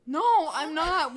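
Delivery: loud, slow, firm no. Acted. Defiant reply to Kylo.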